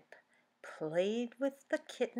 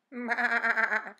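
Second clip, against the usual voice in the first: lamb bleat; 4 semitones higher, 10 dB louder than usual. Acted. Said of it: "said the lambs".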